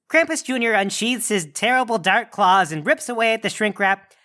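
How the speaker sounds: ordinary talk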